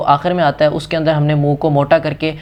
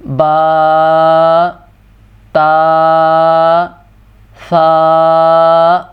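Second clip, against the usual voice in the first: each letter با تا سا drawn long in a recitation tone; 4 dB louder, much slower than usual. Shown as a demonstration. Teacher re-reading the alphabet aloud, drawn-out recitation. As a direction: chant the letters slowly like a Qaida lesson. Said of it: "دوبارہ پڑھتا ہوں".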